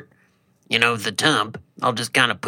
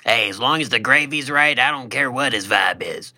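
normal talking voice

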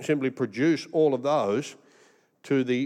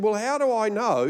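high-pitched officer's query; mock suspicious official; challenging question; 7 semitones higher than usual. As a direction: question it sceptically, voice raised high. Retold customs officer challenging him.

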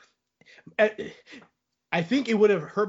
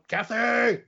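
mock yell; 6 semitones higher, slower than usual. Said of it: "just kinda yelling".

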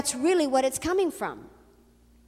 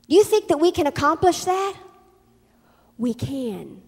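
usual speaking voice

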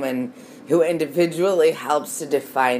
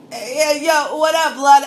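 much higher, louder hail; greeting shout; 13 semitones higher, 9 dB louder than usual. Retold or imitated. Greeting a fellow gang member on the street, loud hail. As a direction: call out a friendly greeting across the street. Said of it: "I was just like".

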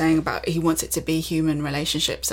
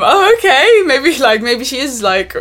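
excited; 8 semitones higher, 9 dB louder than usual. Retold or imitated. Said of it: "And it was like".

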